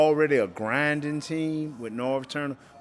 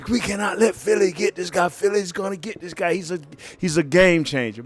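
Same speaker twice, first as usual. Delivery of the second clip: high, urgent mock plea from the coach; lightly voiced coach pleading at the draft table; 7 semitones higher than usual. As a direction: coach begging the room not to lose the player, urgent and high. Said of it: "Wooten stood up on".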